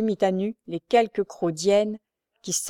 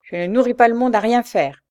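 raised, loud indignant retort; angry outburst; furious scolding; 5 semitones higher, 8 dB louder than usual. A narrator's reading. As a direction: scold as if beside yourself. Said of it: "gronda la mendiante hors d'elle".

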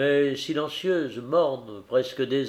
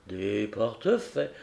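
déconfit, incrédule; 4 semitones lower, 5 dB quieter than usual. Acted. Héros déconfit répète le mot.

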